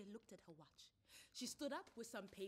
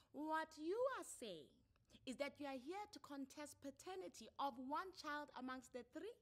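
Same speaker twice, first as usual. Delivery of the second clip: raised, firm official voice; counselor's questions; 8 semitones higher, 5 dB louder than usual. Acted. Counselor questioning a father who stopped paying, firm. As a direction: press him like a family court counselor.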